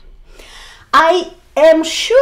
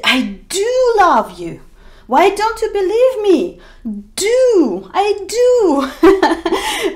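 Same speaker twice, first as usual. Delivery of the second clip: pleading, higher and stressed on "do"; emphatic do; 7 semitones higher, 3 dB louder, slower than usual. Pretend. Lover pleading with a partner who doubts them. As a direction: insist you mean it, rising to a laugh. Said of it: "for emphasis you will use it and you will say".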